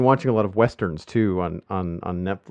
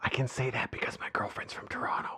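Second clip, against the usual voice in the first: unvoiced, hushed joking aside; whispered aside; 12 dB quieter than usual. Real, not acted. Host whispers a joke about his girlfriend being from Toronto. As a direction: drop to a whisper as if sharing a secret.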